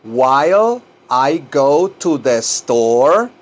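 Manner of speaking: slow, separated words ending upward; drawn-out teacher question; slow model phrase. Shown as a demonstration. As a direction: give learners each word in turn. Teacher models sentence in chunks.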